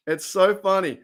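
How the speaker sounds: ordinary talk, nothing marked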